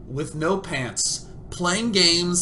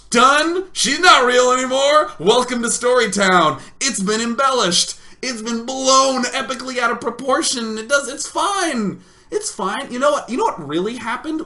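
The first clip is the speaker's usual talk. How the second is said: loud, high mock-defensive proclamation; hammed-up outburst; 9 semitones higher, 8 dB louder than usual. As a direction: burst out defiantly, high and loud. Mock outburst confessing the embellishment.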